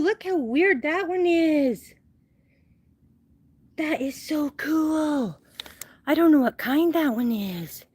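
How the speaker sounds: high, gushing mock tourist voice; mock the cactus-loving tourists. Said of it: "anyone who giggles and looks at them and goes".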